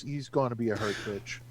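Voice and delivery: ordinary delivery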